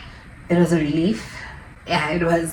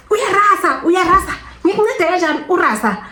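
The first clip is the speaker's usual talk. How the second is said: much higher nagging nurse impression; scolding nurse; 12 semitones higher than usual. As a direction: imitate the nurse scolding her for making noise. Nurse scolding her noise.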